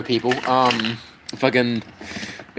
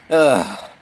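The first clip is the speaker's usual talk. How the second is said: ordinary talk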